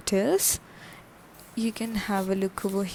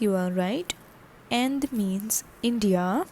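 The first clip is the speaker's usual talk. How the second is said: ordinary talk, nothing marked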